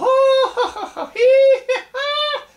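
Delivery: long high peal of laughter; loud laugh. Acted. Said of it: "he laughed some more".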